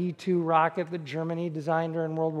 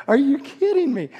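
high-pitched retold amazement; excited disbelief; 6 semitones higher, 4 dB louder than usual. Aviation fan spotting the X fifteen, acting out excited disbelief. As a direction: act out being thrilled and incredulous. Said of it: "I'm speaking like that to the kids".

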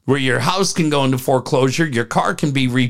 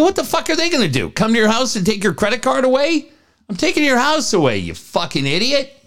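exasperated rant; 8 semitones higher than usual. Retold or imitated. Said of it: "I go".

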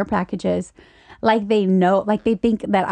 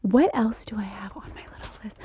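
whispering; 7 dB quieter than usual. Real, not acted. Reading her notes to herself.

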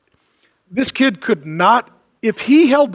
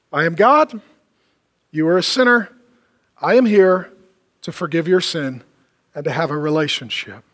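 solemn declaration; 7 semitones lower than usual. Retold or imitated. Preacher voicing God's offer to sinners, solemn and low. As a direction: state it gravely, phrase by phrase. Solemn summary in Jesus' words.

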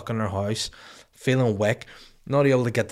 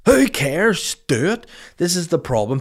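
ranting; 7 semitones higher, 6 dB louder than usual. Real, not acted.

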